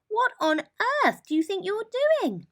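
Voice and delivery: high, loud, exasperated rabbit voice; annoyed Rabbit. A narrator's reading. Woken Rabbit scolds Mole's noise, high and cross.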